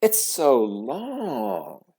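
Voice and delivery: slow, high taunt; mocking drawl. A narrator's reading. Classmate teasing a girl about her long name, mocking. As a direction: draw it out teasingly.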